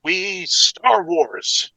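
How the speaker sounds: game-show style host with high, punched countdown calls; big showy countdown host voice; hyped recap announcer. Acted. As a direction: belt out each number, then ease into the comments. Hosts recap as Ralph McGee.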